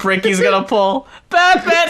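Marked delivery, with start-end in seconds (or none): 1.3-1.9 high-pitched mock call; imitated yell. Co-host riffing on hero at Jenga, mock yelling. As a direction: call out the name in parody. Riff repeating the yelled name.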